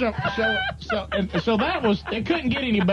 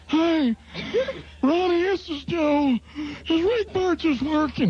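high, slow boss voice over the phone; prank-calling Ronnie in the owner's voice; Joe impression; 9 semitones higher, slower than usual. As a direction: pitch it up and drawl the orders. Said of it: "do this impression so well".

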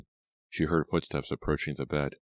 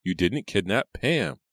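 slow, raised, louder retort; irritated; 5 semitones higher, 5 dB louder than usual. A narrator's reading. Reva answers her caller's guilt, irritated. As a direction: spell it out impatiently, slowly. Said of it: "sounding more irritated than sympathetic".

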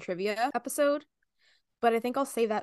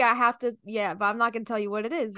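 ordinary talk

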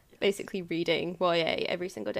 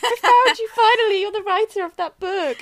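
pitched way up, louder, starstruck; gushing Harry message; 12 semitones higher, 8 dB louder than usual. Pretend. Imagining a message from Harry, voiced high and gushing. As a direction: gush it like a giddy celebrity finally found you. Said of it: "I get a message from Harry".

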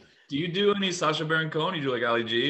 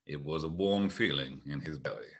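Morgan Freeman; 11 semitones lower, 8 dB quieter than usual. Acted. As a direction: narrate low and unhurried like Freeman. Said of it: "I'm Morgan Freeman".

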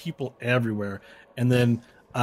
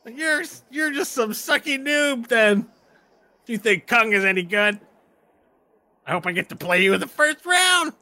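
much higher taunting halfling; 12 semitones higher, 6 dB louder than usual. Acted. Halfling taunting the other player, high mocking. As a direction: needle him like a squeaky bully. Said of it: "my halfling voice".

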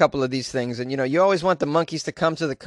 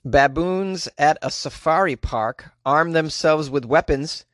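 ordinary delivery

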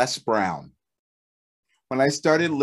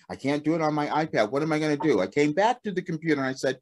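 usual speaking voice

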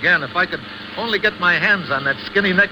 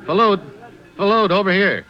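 calls a name across the street, raised; calling out; 3 semitones higher than usual. Acted. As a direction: hail him from a distance.

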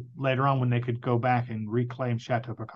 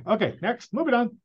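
dismissive mock brush-off; 9 semitones higher than usual. Mocking showrunners skipping the reveal.

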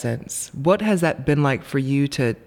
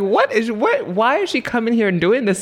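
high, amused mock confusion; excited disbelief; 8 semitones higher than usual. Fan recalling the red dress and crown, high and amused.

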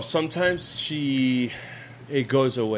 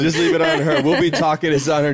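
ordinary talk

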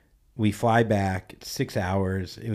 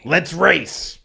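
loud, high mock dare; hammed-up shouted dare; 8 semitones higher, 9 dB louder than usual. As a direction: belt it like a cocky challenge. Mimics the dare again.

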